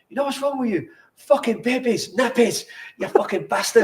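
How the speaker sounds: a lightly done Scottish gaffer tearing into him; a hint of a Scottish accent, ranting. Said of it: "that's a good Scottish accent there".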